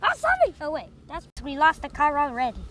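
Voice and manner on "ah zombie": excited shout. Pretend. Playing at zombies after announcing.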